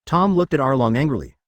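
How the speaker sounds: plain reading voice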